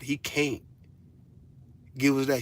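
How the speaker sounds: ordinary delivery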